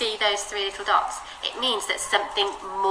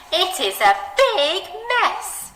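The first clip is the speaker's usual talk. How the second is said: big stretched high and slow; reading aloud with a big expressive BIG; 9 semitones higher, slower than usual. Shown as a demonstration. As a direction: lean on big, draw it out. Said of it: "Should we try it together?".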